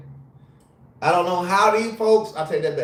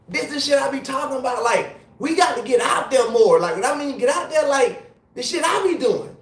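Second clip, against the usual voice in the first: usual speaking voice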